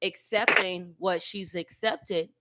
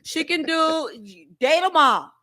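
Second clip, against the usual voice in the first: lightly voiced sassy auntie; a hint of a doting aunt; 5 semitones higher, 8 dB louder than usual. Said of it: "I'm gonna put my auntie voice on for this".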